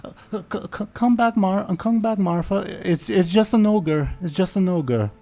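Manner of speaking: high, loud anxious calling out; calling after a friend. Acted. Noblewoman calling her fleeing friend back, high and urgent. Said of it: "Betty calls her friend back".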